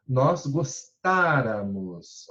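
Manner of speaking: "gostáramos" slow, weight on "tá"; stressed syllable shown. Shown as a demonstration. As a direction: lean on the accented "tá" of "gostáramos". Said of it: "olha só o acento aqui".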